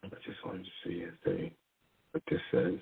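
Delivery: half-voiced mutter while reading; soft muttered aside; muttering to himself. Real, not acted. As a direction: murmur it under your breath, just reading along.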